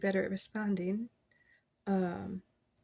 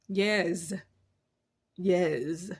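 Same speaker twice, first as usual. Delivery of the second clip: a hint of a reality-TV star's drawn-out yes; mild Trina-style emphatic yes; Trina's voice; 4 dB louder than usual. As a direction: lightly mimic a sassy TV personality. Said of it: "I don't know why I said it like that".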